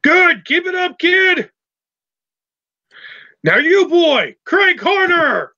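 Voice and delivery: high, drawn-out yelled orders; Mister Bulger yelling. Acted. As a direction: yell at the kid to work harder. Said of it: "Bulger yells at you".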